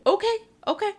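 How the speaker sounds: a high placating okay, okay; de-escalating chef. Retold or imitated. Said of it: "Trying to de-escalate".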